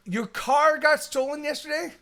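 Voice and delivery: high, loud incredulous question; can't believe what he just heard; shocked disbelief. Real, not acted. Hearing friend's car stolen.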